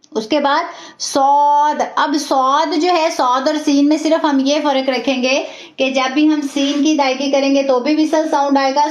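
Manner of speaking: ordinary talk, nothing marked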